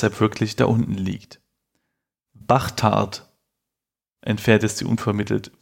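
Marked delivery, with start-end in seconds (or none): 2.3-3.3 Bachtard mit ch statt s; Bachtard ausgesprochen. Shown as a demonstration. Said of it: "Sollte da stehen Bastard oder". (Bachtard mit ch)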